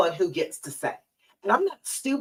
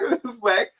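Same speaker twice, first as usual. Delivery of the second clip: ordinary talk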